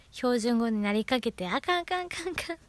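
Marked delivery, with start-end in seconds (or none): 1.5-2.6 flustered self-correction. Retold or imitated. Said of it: "って戻してるところね".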